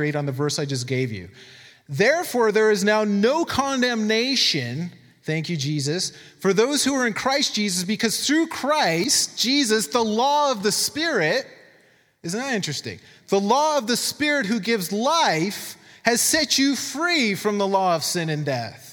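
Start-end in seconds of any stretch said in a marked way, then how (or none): 1.9-4.9 high, slow declaiming of scripture; emphatic scripture reading. Acted. Preacher reads Romans to the church, emphatic scripture reading. 6.4-11.5 high, slow declaiming of scripture; emphatic scripture reading. Acted. Preacher reads Romans to the church, emphatic scripture reading. 13.3-18.9 high, slow declaiming of scripture; emphatic scripture reading. Acted. Preacher reads Romans to the church, emphatic scripture reading.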